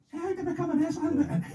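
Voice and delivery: put-on very high, squeaky young teenager; squeaky teenage voice. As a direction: squeak it out like a fourteen-year-old boy. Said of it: "the squeaky voice going".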